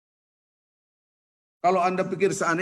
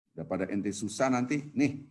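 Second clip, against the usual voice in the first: low, softer grudging offer; unpleasant grudging tone; 7 semitones lower, 4 dB quieter than usual. Acted. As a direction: imitate a giver who begrudges the gift. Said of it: "Ngomongnya itu loh".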